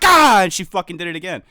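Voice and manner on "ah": loud, high burst of a yell; hammed-up stabbing cry; sudden shouted "ah"; mock jab yell. Acting out the second jab.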